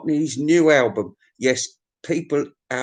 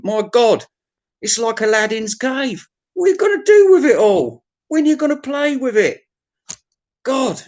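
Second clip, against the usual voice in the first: amazed; 8 semitones higher than usual. Real, not acted. Vlogger marvelling at Anthony's cluttered room, high amazed. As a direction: gasp at the pile of junk.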